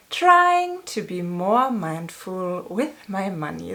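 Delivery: usual speaking voice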